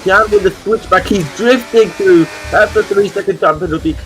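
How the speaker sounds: high, excited overtake call; motorsport commentator. Acted. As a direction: shout the pass live as it happens.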